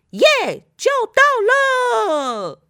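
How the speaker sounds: high, loud, long-held victory shout; triumphant cheer. Acted. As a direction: cheer and stretch out the last words. Frog girl rescues boat.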